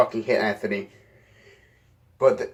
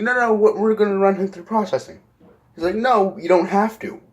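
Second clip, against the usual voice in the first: arguing cops; 8 semitones higher than usual. Retold or imitated. Two officers arguing.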